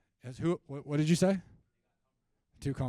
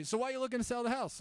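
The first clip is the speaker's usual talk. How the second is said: higher, fast, out-of-the-blue sales question; abrupt question; 11 semitones higher, 4 dB louder, faster than usual. Shown as a demonstration. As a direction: blurt it out of nowhere. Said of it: "you just say".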